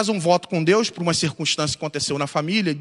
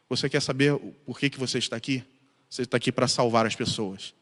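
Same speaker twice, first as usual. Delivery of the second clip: soft, lower voice of God; God's answer; 3 semitones lower, 6 dB quieter, faster than usual. Retold or imitated. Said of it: "estava dizendo pra ele era o seguinte".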